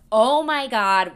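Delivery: slow, loud gasp of delight; drawn-out thrilled exclamation; excited kid. Retold or imitated. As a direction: gush like a kid about to leave for Disney. Child excited about Disney trip.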